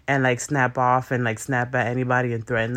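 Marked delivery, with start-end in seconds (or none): none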